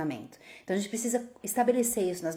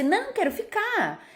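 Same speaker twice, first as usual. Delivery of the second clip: put-on much higher, slow whiny child's protest; 12 semitones higher than usual. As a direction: plead to stay at the park. Criança protesta para ficar. (criança protestando, aguda e arrastada)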